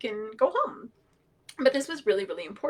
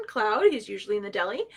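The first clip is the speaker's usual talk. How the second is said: normal talking voice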